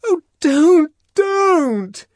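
high, loud shaken plea; high, loud trembling protest as he shakes her; tremulous protest. Acted. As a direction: plead while being shaken hard. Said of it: "in a voice made grotesquely tremulous".

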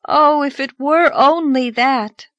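put-on slow, high, sighing girl; a sigh of despair. Anne sighs to the farmer, slow mild sigh. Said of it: "sighed Anne".

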